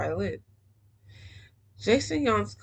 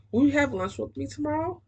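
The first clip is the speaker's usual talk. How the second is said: higher eager ask; eager nerd; 7 semitones higher than usual. Acted. Nerd asks Mom to lunch, higher hopeful voice.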